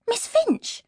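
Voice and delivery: high startled exclamation of the name; surprised cry. Acted. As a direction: call out Miss Finch in shock. Sees her old teacher.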